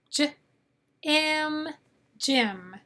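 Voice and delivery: "J, M" as juh, im, then blended into "gym"; "J", pause, "M", pause, then "gym"; sounding out. Shown as a demonstration. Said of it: "why the G says".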